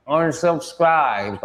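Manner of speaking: stretched-out mocking quote; mock self-important. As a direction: imitate a nobody declaring it as if it matters. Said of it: "like they're significant".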